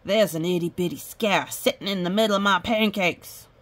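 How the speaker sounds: ordinary delivery, nothing marked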